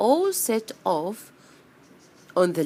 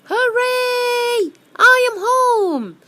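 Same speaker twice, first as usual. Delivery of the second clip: very high, loud, drawn-out cheer; joyful cry; 10 dB louder, much slower than usual. Acted. Greedy bee finally reaches home, cheering. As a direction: shout it triumphantly in a squeal. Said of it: "cried the greedy bee".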